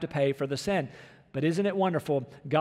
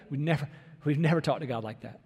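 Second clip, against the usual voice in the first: ordinary talk, nothing marked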